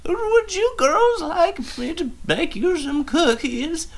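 very high, slow granny voice; sweet old grandma offering cookies; old lady voice. Retold or imitated. Abuela Maggie voiced, old lady.

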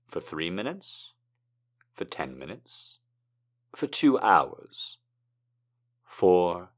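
earnest spoken-word delivery; poetry reading. Acted. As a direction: speak tenderly to a hurt friend. Reads a poem aloud.

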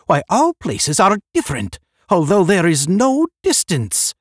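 high, loud proclamation; crying out. A narrator's reading. Another of the group demanding answers, crying out. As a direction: declaim the puzzle loudly. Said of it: "cried another".

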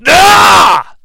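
loud, high-pitched frustrated scream; demonstrated scream. Shown as a demonstration. Critic showing how Aang should fail at waterbending, mock scream. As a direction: let out a big acted yell. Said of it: "Like, scream".

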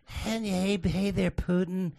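high, soft, slow mock falsetto; bad impression. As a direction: imitate a failed woman-voice impression. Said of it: "it just sounded like a bad".

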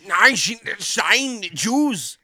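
high, loud mock German barking. Friend parodying German in the show, mock German accent. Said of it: "German would be like".

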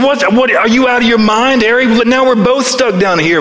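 like a man who can't believe his friend jumped in; playfully acted shock; exasperated disbelief. Bob scolds Harry in the hole.

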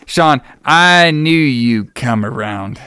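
slow, drawn-out mock drama; theatrically elongated retold line; hammed-up delivery. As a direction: stretch every word for comic effect.

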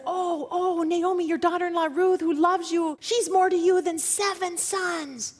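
high, delighted congratulation; joyful town women. Acted. Town women congratulating Naomi on Ruth's baby, high and delighted. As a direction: gush over the good news. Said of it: "the women of the town said".

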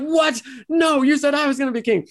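high, indignant squeak of a betrayed braggart; boastful Loki. Retold or imitated. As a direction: whine it up high like a cheated show-off. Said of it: "boastful Loki is like".

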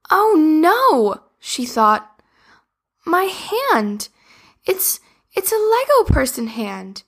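high, startled "oh no"; shocked to find her hand changed; alarmed. Acted. Sophie discovers her Lego hand.

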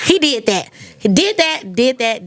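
excited celebration. Real, not acted. Host celebrating champion.

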